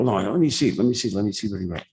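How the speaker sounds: high, hurried mock pleading; eager insistence. Friend begs leaving heckler to see note, eager. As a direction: tumble the repeats out fast and high.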